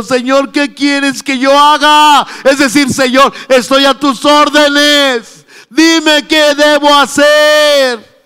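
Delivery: loud, high, slow pleading cry; Saulo pleading. Acted. Saulo begging the Lord for orders, shouting. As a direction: beg for orders, drawing it out. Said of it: "temblando y temeroso".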